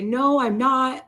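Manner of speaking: loud denial. Retold or imitated. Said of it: "He was like".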